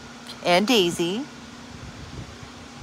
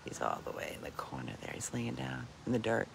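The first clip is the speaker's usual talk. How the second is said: whispering; 7 semitones lower, 15 dB quieter than usual. Real, not acted. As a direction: whisper so the pig can sleep. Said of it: "I don't wanna yell his name 'cause I don't wanna wake him".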